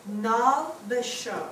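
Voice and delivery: Nal Basho drawn out slowly; slow model reading. Shown as a demonstration. Teacher modelling the word for the class, slowly. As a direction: sound out Nal Basho syllable by syllable. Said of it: "One, two, three".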